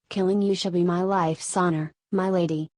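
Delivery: ordinary delivery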